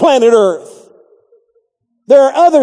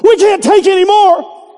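much higher, louder overwhelmed cry; frazzled shout; 10 semitones higher, 4 dB louder than usual. Retold or imitated. Nursery worker overwhelmed by visitors, shouting out. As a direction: blurt it out, swamped and fed up. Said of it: "she stuck her head out and said".

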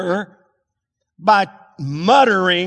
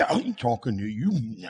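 lower, softer muttered line; wife muttering back at husband, softer; 8 semitones lower, 8 dB quieter than usual. Retold or imitated. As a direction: mutter it under your breath, slowly. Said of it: "she's muttering something".

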